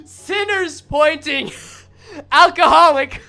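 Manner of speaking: loud laughter through words; laughing. Real, not acted. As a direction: crack up laughing. Laughing hard at scene.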